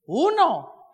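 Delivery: loud, drawn-out disbelief; incredulidad actuada. Retold or imitated. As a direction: blurt it out, mock-incredulous. Scoffing at patient's single heart attack. (incrédulo, fuerte y alargado)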